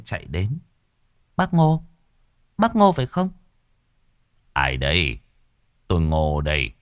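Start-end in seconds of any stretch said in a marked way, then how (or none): 1.4-3.3 high, anxious calling voice; young wife pleading at the door, high and urgent; young widow calling. A narrator's reading. 4.5-6.7 put-on low, gruff old man; old Ngô answering the widow, low and guarded; old man's voice. A narrator's reading.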